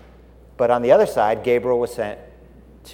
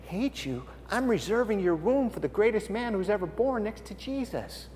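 softer, gentle God's reply; lightly voiced God; 7 semitones higher, 7 dB quieter than usual. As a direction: answer them softly, a hint of God. God answers Zechariah and Elizabeth.

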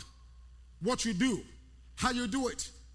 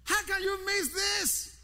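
half-shouted challenge, higher and slow; exasperated call-out; 7 semitones higher than usual. Real, not acted. Preacher scolds the class for missing the point, half-shouting. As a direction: prod the class awake. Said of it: "preacher chides sleepy class".

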